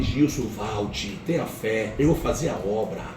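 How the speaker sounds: low, soft, slow divine voice; God calling Gilson home, low and soft. Retold or imitated. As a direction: speak gently and slowly like God calling a son back. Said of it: "Deus dizia pra mim".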